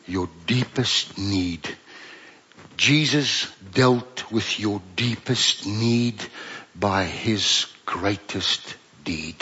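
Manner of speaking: low, slow and hushed, each word weighed; slow solemn preaching. Real, not acted. Preacher pressing the deepest need home, slow and solemn. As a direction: lean in and let it land slowly.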